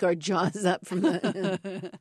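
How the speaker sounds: words break into real laughter; laughing while talking. Real, not acted. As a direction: laugh through the end of the sentence. Laughs while telling the story.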